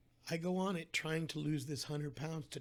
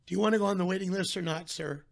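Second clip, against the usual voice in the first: louder, fast, pushy "waiting list or not, sir?"; impatient receptionist; 3 semitones higher, 8 dB louder than usual. Retold or imitated. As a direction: hurry the caller along, louder and fast.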